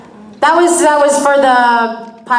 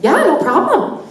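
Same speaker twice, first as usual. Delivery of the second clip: put-on higher, sing-song Midwestern accent; 8 semitones higher than usual. Actress agreeing at her audition, put-on Midwestern accent. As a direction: answer in a chirpy put-on Midwestern lilt. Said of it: "I wanna hear that accent".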